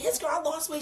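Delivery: high-pitched retold boast; excited. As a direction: replay your own excited chatter. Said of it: "I'm all excited".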